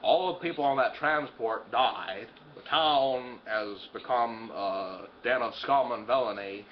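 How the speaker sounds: low, slow, incredulous summing up; low deadpan disbelief; deadpan recap. Acted. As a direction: list the disasters slowly and low.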